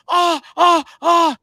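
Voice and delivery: high, sharp cries of pain; pained yelp. Retold or imitated. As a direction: yelp like you just got stung. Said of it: "He, he's like".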